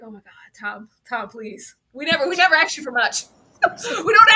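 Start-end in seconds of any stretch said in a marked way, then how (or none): 0.0-1.8 hushed plea. Retold or imitated. Pleading reaction to scene.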